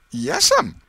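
put-on high, loud anxious boy; a kid about to be caught. A narrator's reading. Boy answers his aunt, high and anxious.

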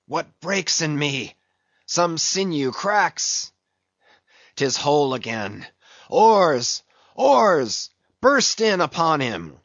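stagger, rally, then roar the crew on; wounded commander rallying into a shout; embattled Ahab. Acted. Ahab's speech in the novel.

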